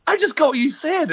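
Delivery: very high, mock-stunned realisation; delayed realisation. Co-host getting the joke minutes later, high mock shock. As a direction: imitate a friend getting it late, high-pitched.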